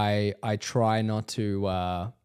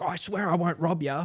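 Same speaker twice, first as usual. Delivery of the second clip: higher-pitched imitated reassurance; fake-friendly stranger; 6 semitones higher, 3 dB quieter than usual. Stranger assures him before a lift, imitated fake friendliness. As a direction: put on a dodgy bloke's harmless act. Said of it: "trying to be nice".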